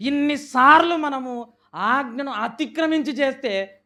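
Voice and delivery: loud, rising sermon cadence, pushing each question; full-force preaching. Acted. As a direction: hammer the congregation with each ఎన్నిసార్లు. (గట్టిగా, ఉద్వేగంగా బోధించే స్వరం)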